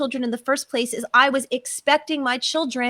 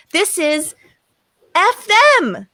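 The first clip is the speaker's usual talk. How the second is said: higher, louder defiant curse; angry outburst; 6 semitones higher, 6 dB louder, slower than usual. Retold or imitated. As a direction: spit it out in sudden anger. Venting rage at parents.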